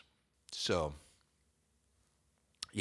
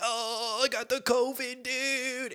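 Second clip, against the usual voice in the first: high whimpering complaint; whiny sick voice; slower than usual. Retold or imitated. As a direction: whine it like a sick kid. Whining sick self.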